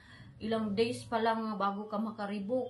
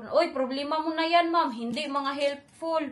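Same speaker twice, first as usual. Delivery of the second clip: dismissive staff; 5 semitones higher, 6 dB louder than usual. Retold or imitated.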